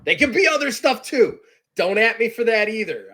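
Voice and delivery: loud, high protest; mock defensive. Real, not acted. Streamer defends his cough to worried friends, loud mock indignation. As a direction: get comically worked up.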